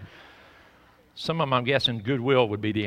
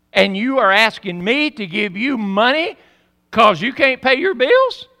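indignant retort; 8 semitones higher, 8 dB louder than usual. Retold or imitated. Pastor confronting the caller who works at Pella, loud and incredulous. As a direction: let the disbelief build.

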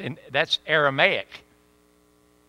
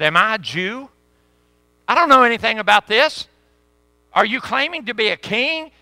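agitated Pilate; 10 semitones higher, 11 dB louder than usual. Acted. Pilate questioning Jesus, acted.

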